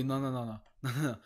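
ordinary delivery

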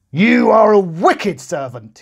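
high, loud, slow furious roar; roaring king. A narrator's reading. The king roaring at the wicked servant. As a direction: roar in righteous anger. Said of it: "he roared".

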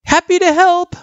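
high, loud answering call; calling back. A narrator's reading. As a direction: call it back across the road. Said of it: "Frisk called back".

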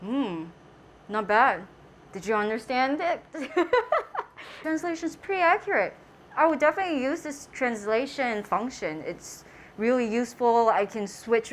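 muffled behind covered mouth; speaking into mask cover; through a face mask. Real, not acted.

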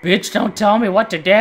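snapping back in mock outrage; play-angry yell at the game. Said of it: "I wasn't even being all that serious".

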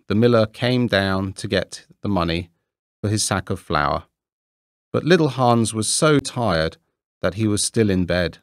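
plain reading voice

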